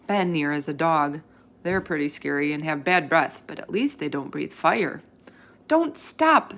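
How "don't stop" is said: high, loud pleading cry; eager kid shouting. A narrator's reading. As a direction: call out so the reader won't stop. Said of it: "Simon shouted".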